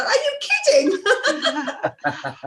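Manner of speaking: high, laughing, mocking astonishment; laughing disbelief. Teasing her American friend, laughing and high. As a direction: laugh at the Stone Age idea in amazement. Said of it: "I go".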